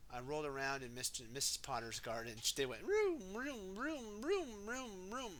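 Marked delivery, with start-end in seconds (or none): 2.8-5.3 high playful engine sounds; engine vroom noises. Acted. Racing machines in the garden, high playful vrooms. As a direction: make the diggers zoom round.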